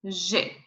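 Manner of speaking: G said "zhay"; French G, soft zh plus "ay"; French letter G. Shown as a demonstration. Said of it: "we have G".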